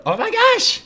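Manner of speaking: high, loud startled cry; surprised shriek. Real, not acted. Streamer shrieking as the cat lunges at the treat. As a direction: yelp it in sudden shock.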